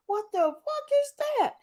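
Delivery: retold stunned outburst; shocked exclamation. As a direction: gasp it out in disbelief. Quoting own shocked reaction.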